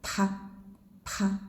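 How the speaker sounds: "Pa" with a puff of breath; aspirated pa. Shown as a demonstration. Teacher demonstrating aspirated pa. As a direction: push air out on pa. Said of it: "unvoiced with breath".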